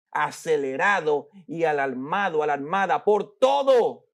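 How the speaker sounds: normal talking voice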